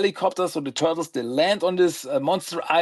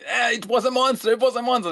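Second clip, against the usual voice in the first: higher, insistent protest; mock protest; 7 semitones higher, faster than usual. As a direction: squeak it out like a flustered turtle. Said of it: "It's like".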